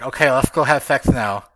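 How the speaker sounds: a playful impression of his partner in a mud mask; mock seductive. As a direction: tease her with a hammed-up come-on. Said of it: "You're like".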